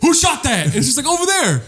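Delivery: high, excited calling out; mock alarmed calling. Acting out running toward gunfire, mock alarm. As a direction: call out as if chasing the shooter.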